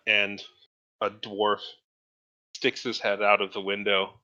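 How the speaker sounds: normal talking voice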